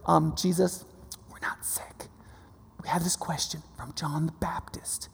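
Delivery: near-whispered, halting aside from timid messengers; hesitant messengers. Retold or imitated. Messengers sheepishly asking Jesus about John's question, hushed. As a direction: put the question very softly, haltingly.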